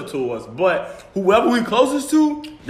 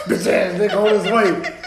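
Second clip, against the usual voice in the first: laughing mock. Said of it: "I was like".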